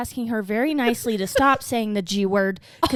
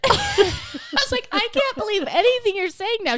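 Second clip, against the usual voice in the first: laughing disbelief; 11 semitones higher than usual. Retold or imitated.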